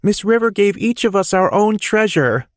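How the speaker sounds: put-on high, bright young boy; child's voice. A narrator's reading. Little boy telling his dad about gifts. Boy's voice, kid explaining.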